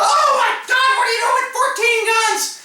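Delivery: high, shrill mock gasp of alarm; freaked out. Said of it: "they freaked out".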